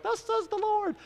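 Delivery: tiny high squeaky critter voice; squirrel voice. Pretend. Pastor imagining a squirrel on his knee, high squeaky prophet voice. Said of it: "a squirrel would have ... been like".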